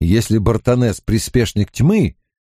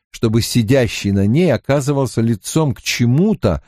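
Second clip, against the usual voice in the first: plain reading voice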